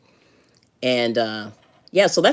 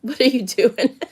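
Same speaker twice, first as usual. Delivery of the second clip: imitating husband; 8 semitones higher than usual. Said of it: "He's probably like".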